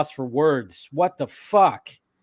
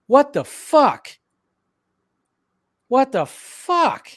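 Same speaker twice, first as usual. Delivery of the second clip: slow, high-pitched shocked swearing; stunned disbelief; 9 semitones higher, slower than usual. Real, not acted. Streamer taking in a sudden death, slow stunned shock. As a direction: draw the words out in real disbelief.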